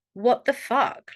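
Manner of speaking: slow, indignant "What the fuck?"; fighting back. Shown as a demonstration. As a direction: stretch it out like you won't take the insult. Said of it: "be like".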